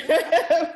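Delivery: a loud, high burst of laughter; host laughing at the guest's reply. Real, not acted. Laughs at "welcome.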